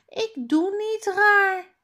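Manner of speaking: put-on high, loud childlike protest. Tom denies acting strange to teacher, loud high protest. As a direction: insist loudly you are not acting odd.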